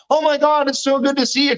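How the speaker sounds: high-pitched mock-friendly gush; gushing greeting. Neighbor's greeting voiced in retold dream.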